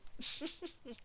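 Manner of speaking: breaks into a high giggle; cracking up at the line; helpless little laugh; real laugh. Real, not acted. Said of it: "it popped me".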